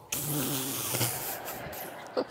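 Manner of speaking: long put-on laugh; imitated gleeful laugh. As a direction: act out a drawn-out laugh of delight. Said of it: "he's going".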